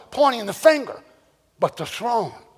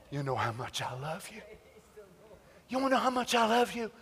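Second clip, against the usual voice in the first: soft, lower, near-whispered love; tender God; 4 semitones lower, 15 dB quieter than usual. Retold or imitated. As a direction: hush a weary child with love. God comforts weary believer.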